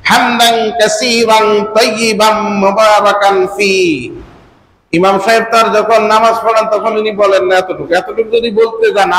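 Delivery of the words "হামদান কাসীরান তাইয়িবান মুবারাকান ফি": হামদান কাসীরান তাইয়িবান মুবারাকান ফি slow and measured; slow recitation. Shown as a demonstration. Preacher teaching the longer tasbih, slow and measured. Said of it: "আরেকটা তাসবিহ আছে".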